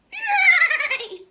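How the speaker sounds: high, loud horse laugh; whinny out a laugh; neighing laugh. Acted. The horse in the stable laughs loudly.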